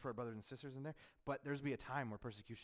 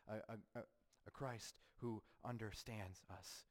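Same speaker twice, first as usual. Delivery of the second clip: ordinary talk, nothing marked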